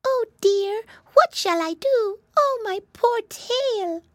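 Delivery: high, tiny wailing voice; acted plaintive cry, last words drawn out; little voice crying. Mouse with her tail trapped, high plaintive crying. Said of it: "they heard a little voice crying".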